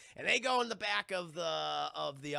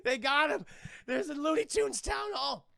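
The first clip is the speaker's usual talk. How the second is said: high, panicked cartoon voice building to a scream; hammed-up frantic wailing; Porky Pig; 10 semitones higher, 4 dB louder than usual. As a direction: raise the alarm, then fall apart in terror. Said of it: "He's like".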